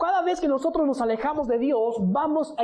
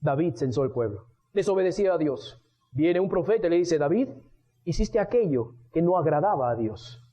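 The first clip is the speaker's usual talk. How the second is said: ordinary delivery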